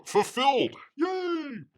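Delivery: high, cheering announcement; playful high-pitched hooray; mock celebration. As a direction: cheer it as a hammed-up quote. Acting out creator's triumphant announcement.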